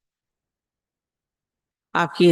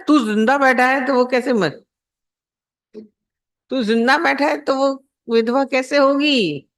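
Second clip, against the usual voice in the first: higher, incredulous scolding of the fool; sadhu's rebuke; 6 semitones higher than usual. Retold or imitated. Sadhu reasoning with the crying man, incredulous scolding. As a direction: point out the obvious like an exasperated holy man. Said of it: "एक साधु प्रवृत्ति बेचारा गुरु".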